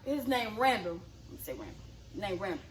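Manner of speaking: name dropped very low, almost under the breath; hushed aside. Real, not acted. Drops voice to give a name.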